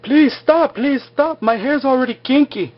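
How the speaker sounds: hammed-up high plea for mercy; mock pleading. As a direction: squeal it out like a man being shocked. [name] begs while being shocked.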